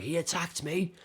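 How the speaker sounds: high-pitched, whiny mock complaint; aggrieved customer. Customer complaining to police about shop worker, mock aggrieved voice. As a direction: imitate an indignant customer pleading his case. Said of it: "And the guy's like".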